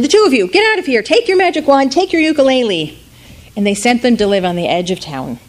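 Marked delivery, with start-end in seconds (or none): 0.0-3.0 higher, fast, scolding crowd; fed-up townsfolk. Acted. Townspeople banishing boy and father, scolding. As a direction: chase them out of town. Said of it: "the people in the town said".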